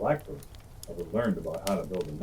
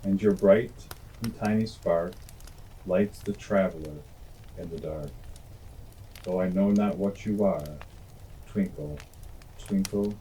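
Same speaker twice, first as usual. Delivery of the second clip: poem recitation. Acted. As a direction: recite gently to children.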